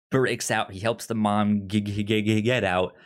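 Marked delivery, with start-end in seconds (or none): none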